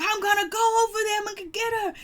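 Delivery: high-pitched mock battle cry; hammed-up high, frantic threat; Kristen. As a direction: imitate someone charging into a fight, high and rushed. Acts out Kristen charging at rival.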